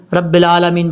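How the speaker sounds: 'رب العالمین' run together, ع as plain a; wrong recitation. Shown as a demonstration. Teacher shows the common mistake, mistaken recitation. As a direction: say 'رب العالمین' without the throat sound. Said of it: "لوگ کیا غلطی کرتے ہیں".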